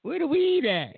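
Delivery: high put-on voice; eager for weed; party girl. She answers the driver, high eager put-on voice.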